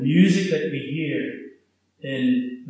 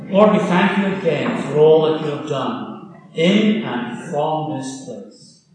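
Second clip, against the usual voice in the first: prayer; 6 dB louder than usual. Acted. Minister leading the congregation in closing prayer, earnest prayer voice. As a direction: lead the church in thanks.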